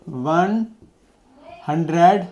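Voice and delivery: each word drawn out, long gap between one and hundred; dictating word by word at writing speed; slow dictation while writing. Shown as a demonstration. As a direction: say one, pause, then hundred slowly and softly, as if writing it down. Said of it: "एक में लिख के दिखा दें".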